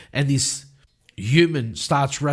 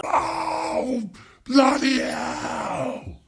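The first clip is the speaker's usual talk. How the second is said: pained cry; much slower than usual. Acted. Barman shot in the knee cries out in pain. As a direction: howl as the shot knee gives way.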